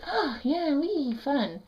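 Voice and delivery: high, singsong playful squeal; mock toddler glee. Toddler treats the hush as a game, high squeal. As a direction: squeal like a delighted toddler playing. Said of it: "made that a game with the baby doll".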